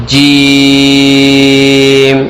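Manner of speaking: جیم stretched very long and sung; drawn out. Shown as a demonstration. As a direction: hold جیم out in a long chant. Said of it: "اس کو کھینچا جائے گا".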